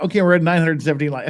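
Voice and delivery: rapid auctioneer patter. Real, not acted. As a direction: rattle off the numbers fast. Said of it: "I sound like an auctioneer".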